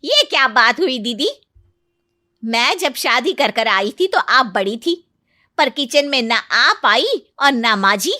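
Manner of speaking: flaring up. A narrator's reading. Aunt-in-law objects that nobody helped her as a bride, flaring up. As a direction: protest hotly, as if affronted. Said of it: "सुनकर मानो बिफर ही जाती है".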